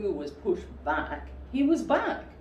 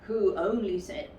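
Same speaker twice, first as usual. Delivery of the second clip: plain reading voice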